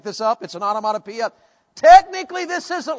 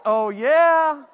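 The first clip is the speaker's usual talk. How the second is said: high, drawn-out, thrilled exclamation; lusty delight; 6 semitones higher, 4 dB louder, much slower than usual. Acted. Preacher acting the reaction to the word ravished, lustily delighted. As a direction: relish it like someone smitten. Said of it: "Aren't you like".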